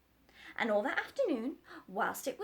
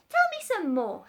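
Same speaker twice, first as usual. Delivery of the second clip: higher, louder eager request; keen to hear more; excited, raised voice; excited doctor; 9 semitones higher, 11 dB louder than usual. Acted. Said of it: "all excited".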